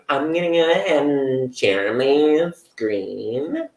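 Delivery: a hint of a teacher sharing their screen. Host imitates a teacher starting a screen share. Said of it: "you're like".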